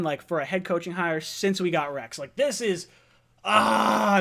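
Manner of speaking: usual speaking voice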